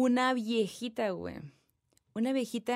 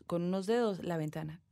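ordinary talk, nothing marked

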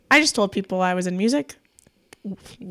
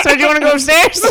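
higher, louder, fast teasing offer; a hammed-up seductive invite; mock flirty; 6 semitones higher, 8 dB louder, faster than usual. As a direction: sell it like a playful come-on. Acted flirty invitation to customer.

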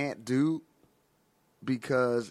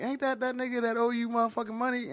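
higher-pitched imitated friend; friend egging him on; 7 semitones higher than usual. Boys on the stoop pointing out a debtor, higher-pitched imitation. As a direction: do your buddy egging you on, pitched up. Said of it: "one of my boys was like".

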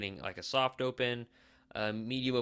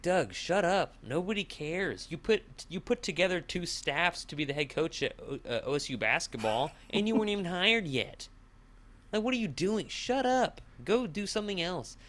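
rising higher and higher, fed-up telling-off; exasperated rant; 6 semitones higher than usual. Retold or imitated. Podcaster telling a radio host to shut up, exasperated rising rant. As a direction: go off on him, getting more worked up line by line. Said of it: "but it's like".

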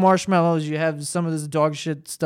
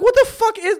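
outraged disbelief; 4 dB louder than usual. Retold or imitated. Outraged at marshmallow Cheerios.

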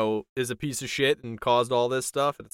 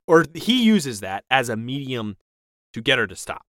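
ordinary talk

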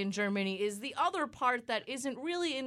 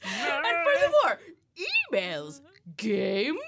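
hammy announcer; 3 semitones higher, 6 dB louder, slower than usual. Pretend.

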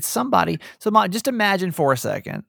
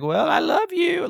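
high, drawn-out mimicry of Mom; mock loving acceptance. Daughter imitating Mom's imagined reply, mock loving. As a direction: put on an implausibly accepting, high voice. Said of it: "just sitting back and being like".

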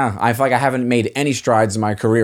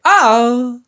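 much higher, louder "Oh" that trails off; mock a local girl's trailing "Oh"; imitating Staten Island girls; 13 semitones higher, 4 dB louder than usual. Shown as a demonstration. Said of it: "Girls talk like this".

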